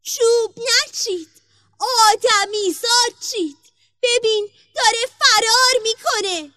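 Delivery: high, loud alarm cry; magic tree shouting. A narrator's reading. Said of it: "درخت فریاد زد و گفت". (فریاد هشدارِ زیر و بلندِ درخت جادو)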